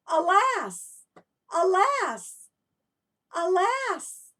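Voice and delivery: high, loud exclaimed Alas; sudden idea. Shown as a demonstration. Children repeat the teacher's idea cry, high loud Alas. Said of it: "Can you do that?".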